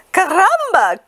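high, loud hen cry; high excited chicken exclamation. Acted. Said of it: "she cried".

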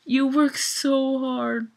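pleading to him. Real, not acted. Fan telling [name] directly, slow earnest plea. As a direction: say it to him as if he could hear.

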